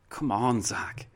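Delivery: ordinary talk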